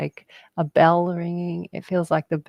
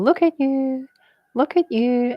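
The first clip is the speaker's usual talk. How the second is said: higher, chanted "Look at you, look at you"; sing-song bird call; 8 semitones higher than usual. Retold or imitated. Retelling the butcher bird's call, sung high and bright. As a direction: lift it like a bird calling from a treetop. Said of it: "he's going".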